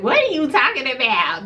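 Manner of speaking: high-pitched cousin impression; imitating cousin. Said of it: "That's how my cousin talk".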